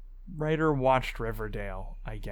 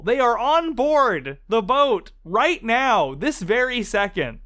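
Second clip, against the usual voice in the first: excited; 7 semitones higher, 3 dB louder than usual. Real, not acted. Excited aside to listeners.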